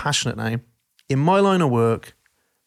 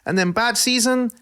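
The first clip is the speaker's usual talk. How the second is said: much higher, louder exaggerated Dutch manager voice; mock Dutch accent; 11 semitones higher, 3 dB louder than usual. Dutch manager mocking the media, exaggerated high Dutch accent. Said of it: "He's like".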